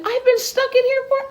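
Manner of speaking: high, fast fretting voice; stuck little butterfly. Acted. Butterfly tangled in the flowers begging Betty, high and hurried. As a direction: rush it out like a trapped little creature.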